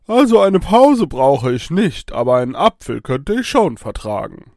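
put-on donkey voice with high, loud swoops; talking donkey. A narrator's reading. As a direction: answer as a cartoonish talking donkey. Donkey answers in human speech. (sprechender Esel, verstellte Stimme)

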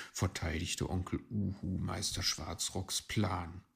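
ordinary delivery, nothing marked

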